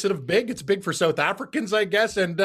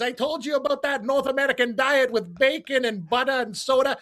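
higher, chummy South African golfer voice; Gary Player impression; 5 semitones higher than usual. Gary Player greeting his friend Cam, lightly voiced. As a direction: greet him as an old golfing pal, lightly South African.

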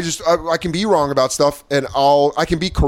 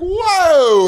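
mock shocked whoa; 6 dB louder than usual. Comic reacting in mock shock.

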